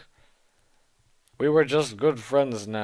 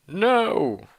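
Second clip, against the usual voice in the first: higher, hammed-up frightened cry; scared shout; 6 semitones higher, 4 dB louder than usual. Heroine crying out in mock fright at Voldemort. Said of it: "I shouted in a scared voice".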